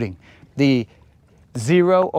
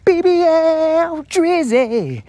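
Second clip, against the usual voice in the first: high, slow, louder hype call of the name; 6 dB louder, much slower than usual. Pretend. Angler on stream hollering a nickname, high hype call. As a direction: belt out the name way up high and stretched. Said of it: "I'm all like".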